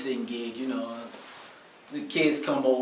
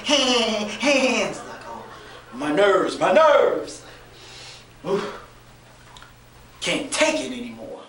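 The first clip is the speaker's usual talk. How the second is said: frazzled; 5 semitones higher, 3 dB louder, slower than usual. Retold or imitated. Frazzled self-quote, nerves.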